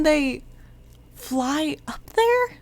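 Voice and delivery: slow, high, baffled question; incredulous question. Real, not acted. Reviewer baffled by flying soldiers, slow incredulous question. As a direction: stretch it out and rise in disbelief. Baffled that army can fly.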